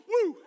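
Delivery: comic "woo"; startled whoop. Acted. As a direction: yelp as if poked behind. Acts branch poking bottom.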